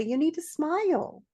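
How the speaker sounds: imitating pushy strangers. Imitates men approaching her in store.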